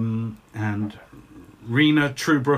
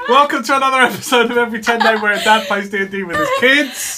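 high, loud intro through giggles; giggly, high-pitched, fast show opener; laughing; 10 semitones higher, 6 dB louder, faster than usual. Real, not acted. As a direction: rush the welcome, fighting off laughter. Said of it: "If you're wondering why we're laughing".

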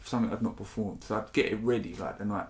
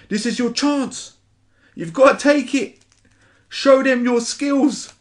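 much higher, loud frantic scream, last line slow; screamed inner voice; 10 dB louder than usual. Acted. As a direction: scream it as the wild voice inside his head. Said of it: "jumped up and screamed out".

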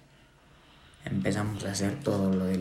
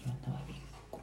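barely voiced admission of being hungover; hushed confession; 12 dB quieter, much faster than usual. Real, not acted. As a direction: mumble it under your breath, a little ashamed. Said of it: "No, dilo, dilo".

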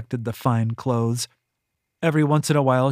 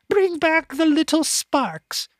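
high, slow sing-song mimicry; mocking predecessor; slower than usual. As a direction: parody her old mistress. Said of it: "she'd say to me".

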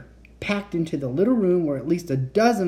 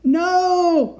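high, loud cry of dismay; horrified cry; 6 dB louder than usual. A narrator's reading. Kat refusing to believe the robots, horrified cry. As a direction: cry out in shock, pitched way up. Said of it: "I cried".

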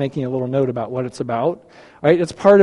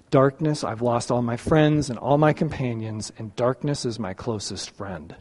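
hushed, heavy and much softer, sinking low; somber psalm reading; 7 semitones lower, 9 dB quieter than usual. Retold or imitated. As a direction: read it like a lament from the dark. Quoting the Psalm of Darkness.

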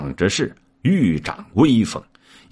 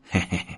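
soft, short put-on chuckle; sheepish chuckle; 8 dB quieter, much faster than usual. A narrator's reading. Monkey caught out before his master, sheepish soft chuckle. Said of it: "躬身笑道".

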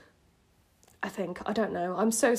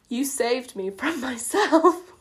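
slow, higher line breaking into laughter; cracking up; 4 semitones higher, 4 dB louder, slower than usual. Acted. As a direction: drag out the cheesy line as laughter takes over. Cracks up reading own cheesy line.

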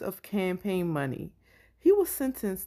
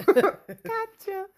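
laughing; 11 semitones higher, 3 dB louder than usual. Real, not acted. Echoing the gotcha while laughing.

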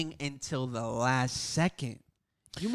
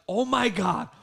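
higher-pitched mounting hype; hyped disbelief; 9 semitones higher, slower than usual. Retold or imitated. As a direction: retell it like hope just came back. Retelling growing hype watching the game.

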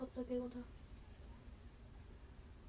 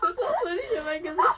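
laughing, shouted much higher and louder protest; 6 semitones higher, 15 dB louder than usual. Real, not acted. Friend gets mask on her face, laughing and shouting. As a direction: yell it through real laughter.

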